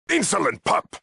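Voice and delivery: high, outraged exclamation; indignant rebuke. Acted. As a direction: explode at an impudent underling. Paladin rebukes an insolent visitor.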